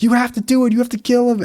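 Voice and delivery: a high, gleeful little instigator; eager high instigating voice. Retold or imitated. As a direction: egg him on in a high kid voice. Voicing Oliver.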